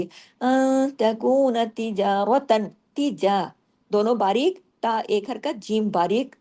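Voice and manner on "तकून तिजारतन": तकून तिजारतन drawn out slowly, Quranic recitation. Shown as a demonstration. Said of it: "अगले हर्फ पे चले जाइए". (धीमी तिलावत)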